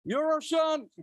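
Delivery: high, ringing roll-call announcement; calling out names. Real, not acted. Organizer calling up partner organizations for applause, calling out. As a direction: proclaim each name high for applause.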